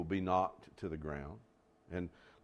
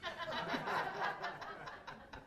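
high laugh; 10 dB quieter than usual. Real, not acted. Laughs at own story.

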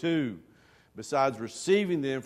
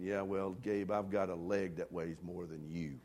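dry muttered reply; 7 semitones lower, 8 dB quieter than usual. Retold or imitated. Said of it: "I'm sitting there the whole time going".

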